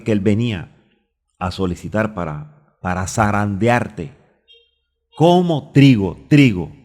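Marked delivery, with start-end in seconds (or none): 2.8-6.7 alza la voz en "trigo", fuerte y lento; prédica enfática. Real, not acted. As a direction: martilla la palabra "trigo" con fuerza. Predicador recalca la cita. (recalca "trigo" en voz alta y lenta)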